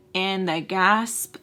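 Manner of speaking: ordinary delivery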